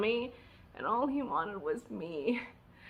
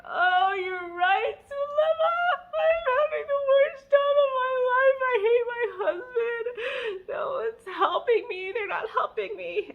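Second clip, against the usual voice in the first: high, loud sobbing wail breaking into laughs; crying call; 4 dB louder than usual. Retold or imitated. New mom crying to her friend on the phone, high sobbing wail. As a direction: wail it to a friend through tears. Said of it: "I called her crying".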